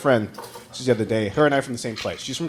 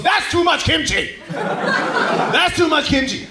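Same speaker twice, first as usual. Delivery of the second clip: put-on higher, slow, scolding old man; 12 semitones higher, much slower than usual. Old man in the store telling the comic off, slow and higher. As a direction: drag it out like a grumpy old shopper. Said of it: "He goes".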